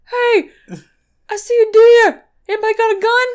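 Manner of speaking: high, loud Kentucky holler; hammed-up backwoods Kentucky yell; mock Kentucky accent. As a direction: call out like a caricatured Kentucky hunter. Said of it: "why is this the accent in Kentucky".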